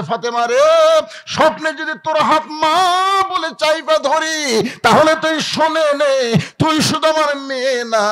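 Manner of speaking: high, chanted acted weeping cry; tearful lament. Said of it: "নবী কান্দার বলে". (কান্নার সুরে উঁচু গলায় টানা ডাক)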